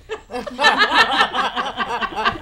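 rising giggle; high laugh. Real, not acted. Laughing at own joke.

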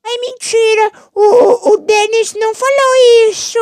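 high, slow whiny mock voice; mocking commenter voice. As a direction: squeak it out like a silly troll commenter. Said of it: "O cara comentou". (voz fina debochada imitando o comentarista)

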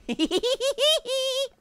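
high rapid tee-hee giggle; impish giggle. Real, not acted. Player delights in revenge on Shrek, impish giggle. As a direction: giggle high in quick pulses.

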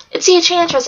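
understated scream; mild scream. A narrator's reading. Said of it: "Alex screamed".

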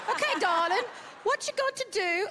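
hammed-up English accent that slips; bad English accent. To the English judge, a bad English accent. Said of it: "I know that was a bad accent".

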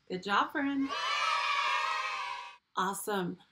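normal talking voice

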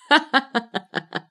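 high staccato laughing fit; compulsive acted laughter. Else laughs as they rush at her, hysterical laughter. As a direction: laugh on and on, helplessly. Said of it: "Ich muss nur immer lachen".

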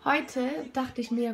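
ordinary talk, nothing marked

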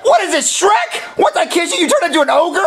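high disgusted yelling; recoil, shouting in horror; disgusted shock. Acted. Kiss turns her into ogre.

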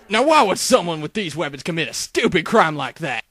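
ordinary delivery

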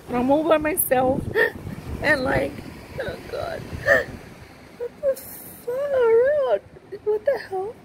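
high, tearful voice breaking up; freaking out, crying. Real, not acted. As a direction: choke out the words through real sobs. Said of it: "I'm freaking out a little bit".

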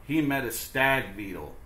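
ordinary delivery

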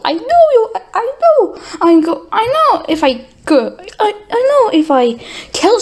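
high, frantic stammering; squeaky flustered babble. Acted. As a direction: trip over the words in a panic. Stammering panicked rant.

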